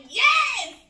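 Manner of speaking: very high, loud cheer; re-enacted thrilled shout. Mom re-enacting cash falling from card, thrilled shout. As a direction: shriek it out in triumph.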